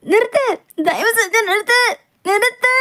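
high-pitched panicked begging; frightened cry to stop the car; panicked plea. Acted. Acted pleading to stop a drive.

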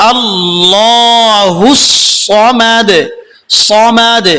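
সামাদ with a heavy emphatic ص, slow chant; tajweed recitation. Shown as a demonstration. Teacher recites the correct word, slow chant. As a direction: chant আল্লাহুস slowly, heavy সোয়াদ in সামাদ. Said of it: "অনেকে আল্লাহুস সামাদ বলেন".

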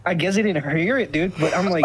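normal talking voice

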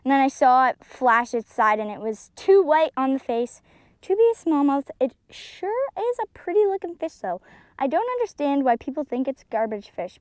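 ordinary talk, nothing marked

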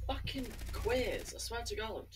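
exasperated. Real, not acted. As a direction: drag it out in disbelief. Raging at game.

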